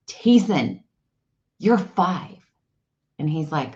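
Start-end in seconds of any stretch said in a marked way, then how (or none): 0.1-2.4 higher-pitched, lightly acted amazement; animated kid-talk. Mother marvelling to her five-year-old son, animated kid-talk. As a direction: marvel at him, pausing after the name. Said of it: "this is how I talk to my kid".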